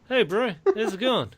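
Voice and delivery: high, slow words through clenched teeth; New Zealand accent. Shown as a demonstration. Demonstrating the New Zealand accent to the hosts, through clenched teeth. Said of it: "you keep your teeth clenched while you're talking".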